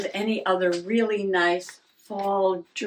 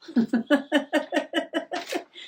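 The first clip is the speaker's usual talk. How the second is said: laugh; 7 semitones higher than usual. Real, not acted. Laughing at the found page.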